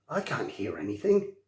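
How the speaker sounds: quiet, worried low murmur; uneasy, straining to listen; anxious. A narrator's reading. Hiccup anxiously tells his friend he hears nothing. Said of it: "said Hiccup anxiously".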